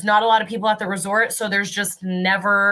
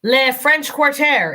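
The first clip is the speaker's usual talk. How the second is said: slow, raised mock-French accent; hammed-up French accent, higher pitched; 5 semitones higher, 3 dB louder, much slower than usual. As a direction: put on a playful French accent, drawn out. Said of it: "in my best French accent".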